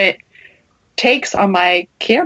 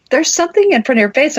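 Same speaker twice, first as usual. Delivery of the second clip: urgent alarm; 7 semitones higher, faster than usual. Retold or imitated. Urgent warning to cousin.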